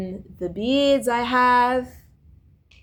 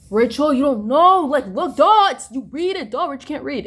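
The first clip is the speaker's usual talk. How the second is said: mocking friend; 5 semitones higher, 6 dB louder than usual. Retold friends mocking her, acted.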